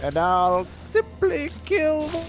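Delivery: high, slow cartoon frog voice. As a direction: drawl it out like a goofy Flash cartoon frog. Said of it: "are you doing a legendary frog voice".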